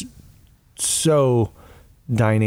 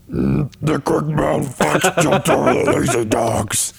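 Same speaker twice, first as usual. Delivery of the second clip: Dikembe Mutombo; 4 semitones higher, slower than usual. Pretend. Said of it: "Dikembe Mutombo".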